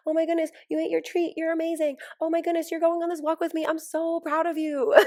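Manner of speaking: high, loud, gushing praise for a dog; high sing-song praise to a new puppy; doting puppy talk. Retold or imitated. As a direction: coo over the puppy like a proud mom. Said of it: "I'm like".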